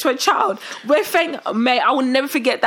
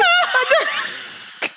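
ordinary talk, nothing marked

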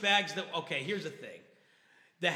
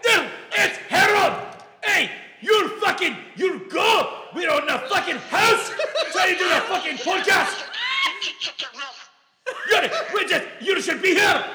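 excited phone yelling; 10 semitones higher, 8 dB louder than usual. Real, not acted. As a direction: shout at your friend down the phone. Drunken yelling at friend on phone.

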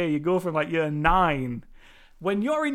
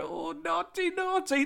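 high, soft mock scolding; teasing meme voice; 9 semitones higher, 5 dB quieter than usual. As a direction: imitate a playful "naughty" meme, pitched up. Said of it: "it's like that meme".

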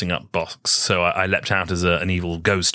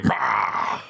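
dinosaur voice; 2 semitones lower than usual. Shown as a demonstration. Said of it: "You gotta practice it".

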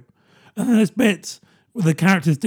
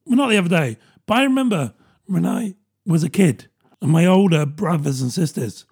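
ordinary delivery

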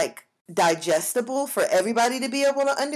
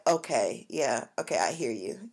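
low, quiet placating acknowledgement; 10 semitones lower, 6 dB quieter than usual. Retold or imitated. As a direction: go soft and low, humoring an angry partner. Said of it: "whenever he's angry, I'll be like".

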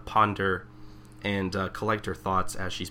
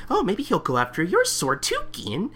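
high, light mock-innocent Shinpachi voice; teasing sidekick voice; imitating Shinpachi; 11 semitones higher than usual. Friend retelling anime scene, doing Shinpachi mock-innocent. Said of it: "Shinpachi is just all like".

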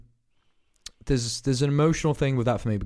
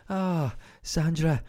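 mock-mournful Scottish psychic, slow and drawn out; a hint of a grieving medium reaching the spirits; Limmy's clairvoyant; 4 semitones higher, 5 dB quieter, much slower than usual. Comic impressing Limmy's clairvoyant sketch, slow mock-mournful. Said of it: "He does a clairvoyant".

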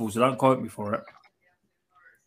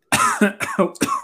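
hard coughing fit; choking cough; 7 semitones higher than usual. Real, not acted. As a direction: cough on food stuck in throat. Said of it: "See, we're both coughing now".

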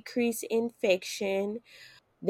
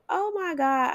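amazed; 8 semitones higher than usual. Retold or imitated. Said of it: "And I was like".

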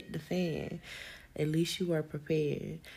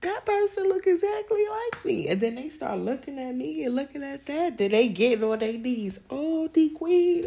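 much higher and louder, thrilled; excited; 10 semitones higher, 7 dB louder than usual. Real, not acted. Excitedly imagining people bowing to her as queen. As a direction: soar up with delight. Fantasy climax.

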